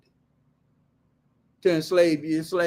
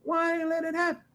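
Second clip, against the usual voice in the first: a kid's question, voiced much higher and softer; mock kid voice, much higher and softer; curious kid; 10 semitones higher, 7 dB quieter than usual. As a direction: pipe up like a smart-ass schoolkid asking. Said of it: "asks up his hand and says".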